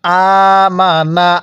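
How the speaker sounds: আমানা held slowly, each vowel stretched one alif; slow tajweed elongation of আমানা; drawn-out recitation. Shown as a demonstration. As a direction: chant আমানা slowly for learners to copy. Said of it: "কিভাবে পড়ব?".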